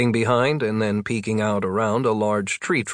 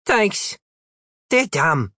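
put-on little girl voice; 9 semitones higher, slower than usual.

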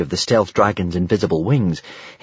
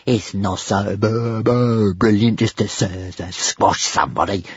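stuttering little dragon voice; jealous hiss. A narrator's reading. Toothless resents the praise, hissing and stuttering. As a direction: stutter on the first sounds, jealously. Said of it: "hissed Toothless in a jealous fury".

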